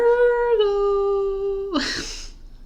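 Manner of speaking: long, high squealing giggle; high giggling fit. Real, not acted. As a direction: lose it in a high, helpless giggle. Giggles after saying Virgo.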